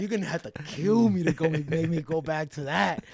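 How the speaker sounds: loud, high-pitched riff through laughter; laughing boast. Real, not acted. Fighter joking about leaving poverty behind, laughing loudly. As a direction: crack up while boasting, voice way up.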